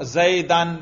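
زیدن said as zaydan, ending "-an"; accusative ending. Shown as a demonstration. Teacher quizzes students on the case heard, زیدن pronounced zaydan. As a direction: close زیدن on an open a-n sound. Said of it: "نصب".

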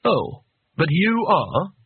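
overly courteous observation; very polite. A narrator's reading. Man addresses the locked-in master, as politely as possible. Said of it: "as politely as possible".

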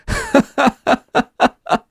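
high breaking laugh fit; laughing until it hurts; helpless laughter. A narrator's reading. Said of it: "Фаусту стало смешно до колик".

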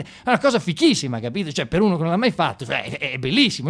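usual speaking voice

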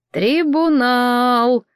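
long, thoughtful drawling of one word; pensive drawl. A narrator's reading. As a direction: stretch every syllable out while thinking it over. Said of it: "протянул эльф задумчиво".

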